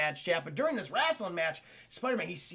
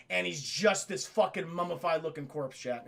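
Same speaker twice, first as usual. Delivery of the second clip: ordinary delivery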